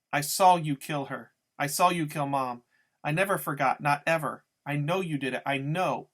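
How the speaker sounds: loud, relentless accusing shout; screaming accusation. A narrator's reading. Boy screaming at the killer, loud accusation. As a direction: hurl it at him over and over. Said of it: "all I do is keep screaming".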